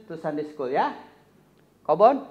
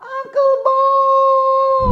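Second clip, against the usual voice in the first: much louder, very high, long drawn-out yell; loud shout; 13 dB louder, much slower than usual. Acted. Puppet Kobon yells even louder for Uncle Bob to come. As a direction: shout it with everything, so he hears. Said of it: "Kurang kuat, dia nggak denger".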